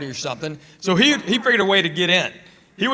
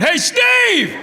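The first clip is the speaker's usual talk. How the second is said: much higher, louder exasperated shouting; crowd yelling; 5 dB louder, much slower than usual. Retold or imitated. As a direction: yell like an annoyed crowd pointing him out. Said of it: "people we don't even know are going".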